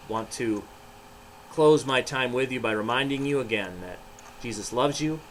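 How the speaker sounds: plain reading voice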